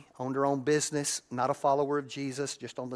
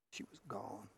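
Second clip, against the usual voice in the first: hushed grief; 5 semitones lower, 17 dB quieter than usual. Real, not acted. Grief, trailing off.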